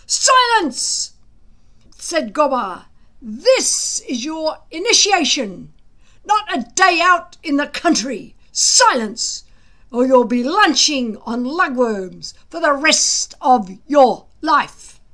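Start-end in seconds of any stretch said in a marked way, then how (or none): 0.1-1.1 a sudden high loud bark; shouted command. A narrator's reading. Gobber silencing the bickering boys, loud shout. 3.2-15.0 angry threat. A narrator's reading. Gobber threatening the boys with lugworms, loud stern threat.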